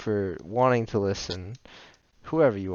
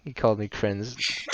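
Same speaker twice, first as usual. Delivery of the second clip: usual speaking voice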